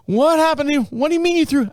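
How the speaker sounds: high mock wife voice, amused disbelief; imitating laughing wife. Wife laughs at husband's back injury, high mock voice. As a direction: pitch it up like a wife cracking up. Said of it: "She starts laughing".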